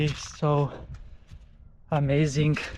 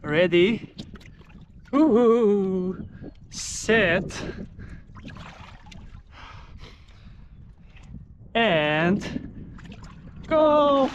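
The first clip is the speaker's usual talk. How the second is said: excited countdown; 4 semitones higher, much slower than usual. Real, not acted. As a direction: count down, bracing for the plunge. Countdown before plunging into ice.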